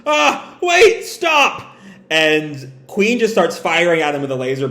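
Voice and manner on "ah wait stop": high, strained panicked plea; panicked cry. Acted. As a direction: beg him to stop, voice jumping up. Sanji panics at his body.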